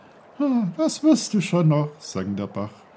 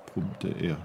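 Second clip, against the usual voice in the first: plain reading voice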